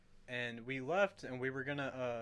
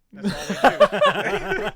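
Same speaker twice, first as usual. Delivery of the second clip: laughing; 16 dB louder, much slower than usual. Retold or imitated. Laughing while quoting Monkey.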